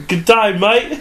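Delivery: drawn-out Australian greeting, rising at the end; mock Australian accent. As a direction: put on a slow Australian accent. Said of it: "it's all Australian".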